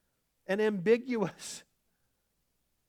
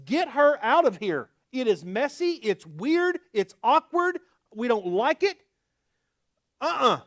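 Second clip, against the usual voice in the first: louder, higher, fed-up brush-off; exasperated disciples; 6 semitones higher, 8 dB louder than usual. Acted. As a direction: wave her away like an annoyance. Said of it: "The disciples' solution to this problem was".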